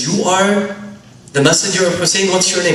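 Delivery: high, loud disbelief; astonished. Acted. Son stunned that the stranger is Hussein's messenger, surprised. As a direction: voice jumps up in shock.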